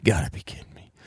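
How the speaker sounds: soft unvoiced whisper; a whispered aside of disbelief. Real, not acted. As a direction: breathe it out in disbelief. Mock disbelief at unprepared people.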